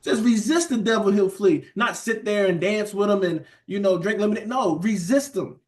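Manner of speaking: high, rising preacher's exhortation; preaching at full force. Acted. Preacher urging the congregation to resist doubt, fired-up preaching. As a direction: drive it home to the church, pitch climbing.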